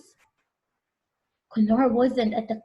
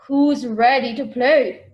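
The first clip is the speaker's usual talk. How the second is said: slow, loud host call to the crowd; game show host; 6 dB louder than usual. Acted. Host opens the watermelon race, loud and slow. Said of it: "the host asked".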